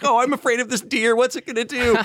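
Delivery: high, squeaky fake fright; mock-frightened squeak at a tiny deer; mock scared. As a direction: play up being spooked by a deer. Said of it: "I'm still just like".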